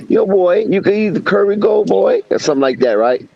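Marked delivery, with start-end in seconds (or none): 0.1-2.2 high Jamaican lady, teasing; higher, imitating a Jamaican cook; Jamaican accent. Imitating the Jamaican cook offering curry goat, Jamaican accent. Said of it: "in that Jamaican voice".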